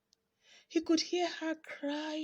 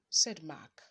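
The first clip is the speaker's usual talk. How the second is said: usual speaking voice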